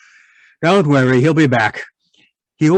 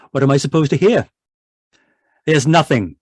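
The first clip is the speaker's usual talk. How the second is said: normal talking voice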